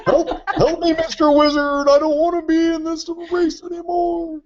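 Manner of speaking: high, whiny plea for help; high pleading voice. Pretend. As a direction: beg for help in a high silly voice. Joking Bernie plea.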